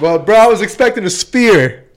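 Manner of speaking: loud, high playful protest; half-joking indignant outburst; loud mock complaint. Real, not acted. Jokes about wanting big ice.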